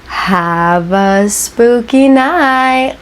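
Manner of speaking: very slow, stretched-out catchphrase; playfully drawn-out spooky farewell; spooky sign-off. Pretend. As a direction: draw every word out long and eerie. Drawn-out signature sign-off to viewers.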